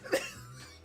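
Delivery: helpless high laughter at a shocking line; high-pitched laugh. Real, not acted. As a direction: burst out in a high squealing laugh. Cracking up at her own retelling.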